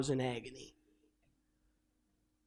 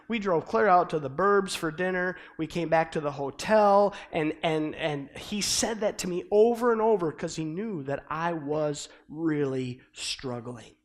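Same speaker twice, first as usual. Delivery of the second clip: normal talking voice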